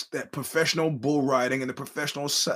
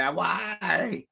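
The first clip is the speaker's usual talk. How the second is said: higher mock cry of why; a lightly voiced injured skater's wail; Nancy Kerrigan wail; 5 semitones higher than usual. Mock skater wailing after the attack. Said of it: "Nancy Kerrigan after she got".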